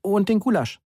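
lightly acted shout-after; calling after someone. A narrator's reading. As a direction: call it after them, pitched well up. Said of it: "rief ich ihm hinterher".

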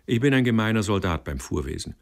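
plain reading voice

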